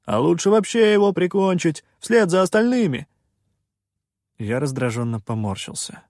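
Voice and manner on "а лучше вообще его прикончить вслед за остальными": high, loud, bloodthirsty heckle; crowd shout. A narrator's reading. Villager urges killing the pirate, angry shouting. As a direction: egg the mob on loudly. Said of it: "выкрикнул кто-то из-за его спины".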